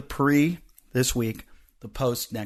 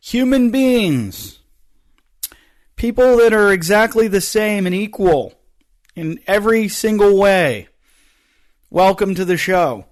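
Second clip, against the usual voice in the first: parody announcer; 10 semitones higher, 10 dB louder than usual. Pretend. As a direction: blast the opener like an overblown host. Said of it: "I'm gonna open the show with".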